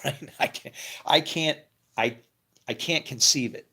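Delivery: usual speaking voice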